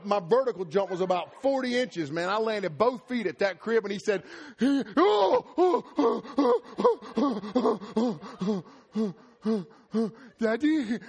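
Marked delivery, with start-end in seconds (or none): 4.6-11.0 put-on high, loud little-kid oh oh oh Daddy; loud high childish wail, Daddy drawn out; toddler whimpering. Toddler crying after dad lands.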